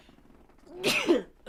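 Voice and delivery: sharp real cough; a sudden hard cough. Real, not acted.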